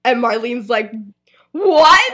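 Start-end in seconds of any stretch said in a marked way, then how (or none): 1.5-2.1 high, loud shouted "What?"; loud shocked what. Acted. Marlene reacting to the team news, loud shout. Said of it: "she said it loudly".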